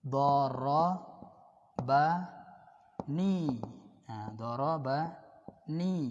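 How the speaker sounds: "Dorobani" said slowly with a rising end; slow teaching model. Shown as a demonstration. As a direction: stretch out "Dorobani" so students can copy it. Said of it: "dia telah mukul saya". (ucapkan "Dorobani" pelan-pelan)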